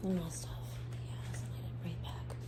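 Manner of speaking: soft whisper to herself. Real, not acted. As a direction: barely whisper it. Muttering while fetching things.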